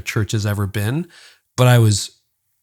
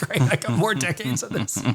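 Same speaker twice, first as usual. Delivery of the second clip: mock dismay; 6 semitones higher, 5 dB quieter than usual. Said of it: "I'm like".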